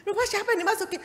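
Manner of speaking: high laughing repeat of the question; laughing echo. Retold or imitated. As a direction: echo her back, laughing. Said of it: "Saya bilang".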